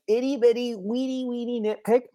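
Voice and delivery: put-on high little baby-talk squeak; playful tiny voice. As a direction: shrink it into a high, teeny voice. Playfully shrinks her own small complaint.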